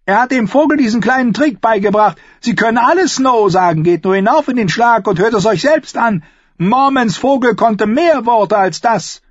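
high, loud sneering voice; mocking. A narrator's reading. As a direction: sneer it out to the whole hall. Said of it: "spöttischem Gelächter".

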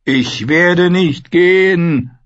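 high, drawn-out wolf-like howl; defiant howl. A narrator's reading. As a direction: cry it out like a howling wolf. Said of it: "hob den Kopf und heulte". (trotziges, langgezogenes Heulen)

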